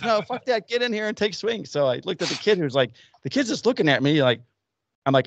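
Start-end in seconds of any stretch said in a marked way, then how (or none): 0.0-1.7 put-on insistent old coach. Coach insists, overriding him.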